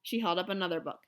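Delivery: ordinary delivery, nothing marked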